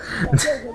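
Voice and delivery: short high giggle; a quick amused laugh; real laugh. Real, not acted. Player laughing at the stealing, short high laugh.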